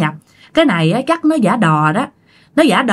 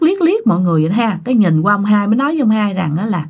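ordinary delivery, nothing marked

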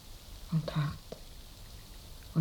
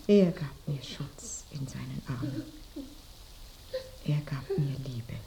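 whisper; 3 semitones lower, 4 dB quieter than usual. Acted. Dying woman whispers of husband.

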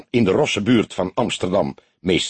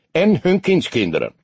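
joking toast; 8 semitones higher than usual. A narrator's reading. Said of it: "schertste hij vrolijk".

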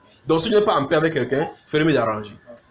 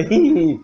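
loud, high mock wail; hammed-up whining baby cry; imitated baby crying; 5 semitones higher, 6 dB louder than usual. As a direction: bawl like an infant, put on. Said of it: "Comme ça".

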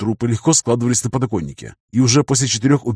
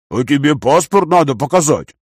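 bass voice; 3 semitones higher, 3 dB louder than usual. A narrator's reading. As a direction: boom it in a bass voice. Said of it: "своим басовитым голосом".

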